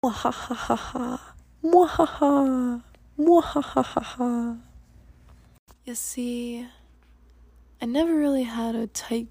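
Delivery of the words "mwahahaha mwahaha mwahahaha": slow theatrical evil cackle; evil villain laugh. Acted.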